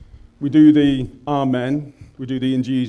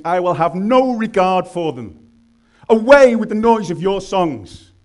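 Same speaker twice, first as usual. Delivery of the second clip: rising prophetic rebuke; 7 semitones higher, 5 dB louder than usual. Acted. Climax of God's rebuke.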